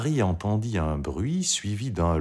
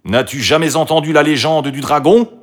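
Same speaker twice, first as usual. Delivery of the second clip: high, loud, urgent warning; alarmed brothers' warning; 7 semitones higher, 7 dB louder than usual. A narrator's reading. Brothers warn Marie off the dragon's stairs, alarmed and loud. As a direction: raise the voice in a forceful caution.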